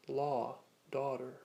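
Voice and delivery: North American "law, daughter" with open aw and sounded final r; North American accent. Shown as a demonstration. Teacher giving North American example words, American open aw in "law, daughter". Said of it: "in North American style accents".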